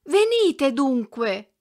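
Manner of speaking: "Venite dunque!" cried out high, loud and drawn out slowly; shout. A narrator's reading. Girl calling after the retreating hunchback, loud slow cry. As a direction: call after him at full voice. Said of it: "ella gridò".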